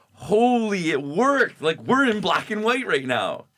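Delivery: high, loud burst of awe; re-enacted astonished shout; amazed discovery. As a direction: exclaim it like a sudden breakthrough. Acting out amazed discovery moment.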